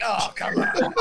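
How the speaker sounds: high, laughing mock protest; hammed-up whine of disbelief; mock exasperation. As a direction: complain like an aggrieved fantasy owner, half laughing. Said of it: "We're like".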